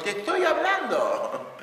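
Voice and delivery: hammed-up scolding of a child, half laughing; mock parent yell. Shown as a demonstration. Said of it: "Entonces, ¿qué decimos?". (grito exagerado de padre)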